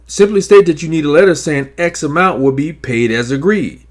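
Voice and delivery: ordinary talk, nothing marked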